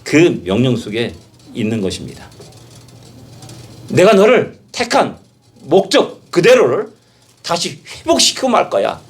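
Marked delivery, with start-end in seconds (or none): 3.9-9.0 high, loud and slow, each word pressed firmly; resolute command. Acted. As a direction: declare it with unbending resolve. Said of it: "라는 단호한 목적이". (높고 크게, 천천히 단호하게)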